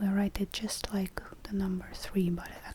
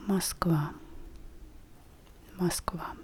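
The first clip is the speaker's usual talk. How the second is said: ordinary talk, nothing marked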